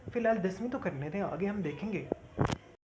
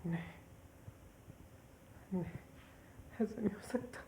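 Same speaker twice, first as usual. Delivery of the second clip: wailing higher and higher through sobs; crying; 7 semitones higher than usual. Acted. Daughter learns her father is dead and breaks down wailing. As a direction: grief spilling over into wails.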